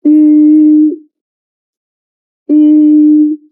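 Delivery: Ü as ee through tightly rounded lips, loud and held; rounded ü vowel. Shown as a demonstration. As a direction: hold Ü with whistling lips. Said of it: "Just change your lips shape to a very small circle".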